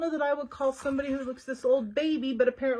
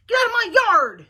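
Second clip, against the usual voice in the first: a much higher, much louder furious yell; angry shout; 10 semitones higher, 12 dB louder than usual. Acted. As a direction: chase the pig off the property. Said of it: "she shouted at Mercy".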